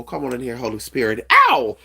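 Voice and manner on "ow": high excited yelp. Real, not acted. Shouting in spiritual excitement.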